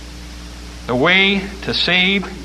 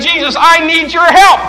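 pleading mother; 12 semitones higher, 8 dB louder than usual. Acted. Mother persists.

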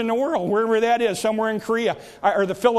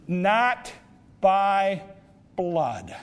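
slow deliberate note; 2 semitones lower, much slower than usual. Acted. Evangelist writes a slow note.

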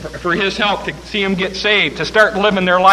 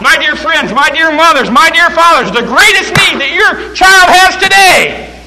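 rising, louder preacher's cry building up; fiery preacher crescendo; preaching at full force; 6 semitones higher, 6 dB louder than usual. Acted. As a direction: build the sermon to a shouted peak.

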